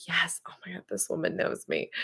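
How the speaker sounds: whispered, breathless delight. Retold or imitated. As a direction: hush it like a secret thrill. Thrilled reaction told as secret.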